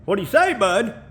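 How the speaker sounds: put-on high bouncy Canadian accent; playful Canadian greeting; mock Canadian accent. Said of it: "I can't sound Canadian".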